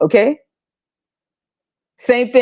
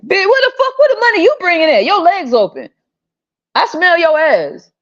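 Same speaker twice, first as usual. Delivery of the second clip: high furious rant at mothers; high indignant scolding; outraged rant; 9 semitones higher than usual. Real, not acted. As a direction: tear into her, pitch way up.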